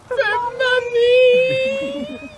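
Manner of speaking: very slow, high deadpan answer; drawn-out silly sailor. Acted. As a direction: stretch it out innocently.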